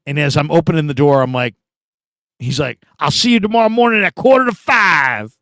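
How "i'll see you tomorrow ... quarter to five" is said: coach's voice. Retold or imitated. Coach dropping him off late.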